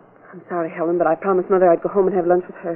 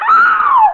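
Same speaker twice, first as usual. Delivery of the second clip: piercing high scream, loud; terrified scream; 8 dB louder than usual. Acted. Clerk struck by the wrench, piercing scream.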